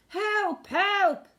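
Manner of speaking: high panicked cries for help; man screaming in alarm. A narrator's reading. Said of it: "the man was screaming".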